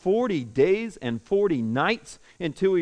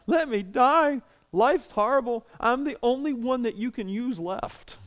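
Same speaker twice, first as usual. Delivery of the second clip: high-pitched whining complaint; whiny Elijah; 6 semitones higher than usual. Retold or imitated. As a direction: whine at God like a sulking child. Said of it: "he's almost whiny".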